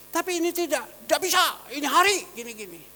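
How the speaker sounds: ordinary talk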